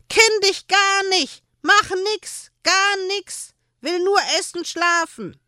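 hohe, langsame Koboldstimme, laut gebrüllt; brüll das Weib an wie ein wütender Kobold; brüllend. Acted. Erwing verteidigt sich gegenüber Hanne. (Erwing brüllt die Händlerin an)

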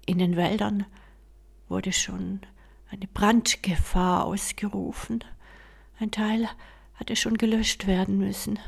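ordinary delivery, nothing marked

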